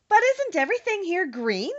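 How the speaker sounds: a hint of a puzzled young girl; Dorothy surprised. A narrator's reading. Dorothy questions the wizard, lightly voiced surprise.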